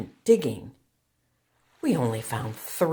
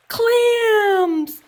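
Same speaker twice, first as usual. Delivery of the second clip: high, loud joyful cry of Clams; a big happy shout; excited shout; 11 dB louder than usual. A narrator's reading. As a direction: burst out with the thrill of finding them. Excited discovery in read story.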